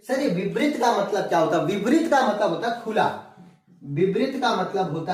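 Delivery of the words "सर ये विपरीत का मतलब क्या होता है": ordinary delivery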